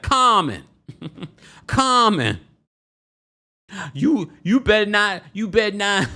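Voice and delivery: high, loud mock scolding; imitating Mama Joyce voice note. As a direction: shout a warning like an angry mother. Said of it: "'cause Mama Joyce like".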